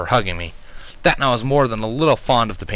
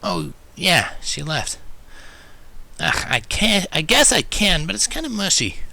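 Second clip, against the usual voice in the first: grudging Rainbow; 9 semitones higher, slower than usual. A narrator's reading. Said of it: "hesitating and grinding to a halt".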